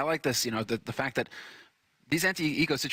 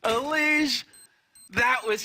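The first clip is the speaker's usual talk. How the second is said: high, excited, amazed cry; excited caster shout; 4 dB louder than usual. Real, not acted. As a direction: yell out the clutch. Caster reacts to a clutch.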